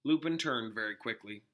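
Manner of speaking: plain reading voice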